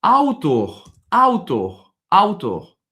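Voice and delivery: "Autor" said loud three times with a diphthong AU; repeated Portuguese word. Shown as a demonstration. Teacher drills the word for the student, loud clear repetition. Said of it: "on se rattache au français".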